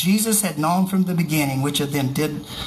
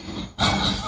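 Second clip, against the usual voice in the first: quiet breathy laugh; 3 dB quieter than usual. Real, not acted. Laughs at Jesus' retort.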